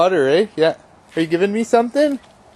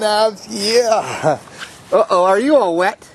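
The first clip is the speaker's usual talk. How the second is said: ordinary delivery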